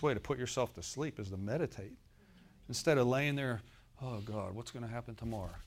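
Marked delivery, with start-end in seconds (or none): 4.0-5.5 quick, soft anxious mutter at bedtime; mock worried fretting. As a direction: fret quietly like someone lying awake. Mimicking a worried sleepless believer.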